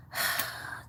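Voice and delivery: long, soft breathy sigh; soft exhale of dread; weary sigh. Real, not acted. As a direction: let out a quiet, resigned sigh. Dreading the gym, sighs mid-sentence.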